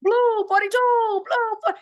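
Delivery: playful imitation of a quarterback call; quarterback cadence. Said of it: "quarterback does his K-ins".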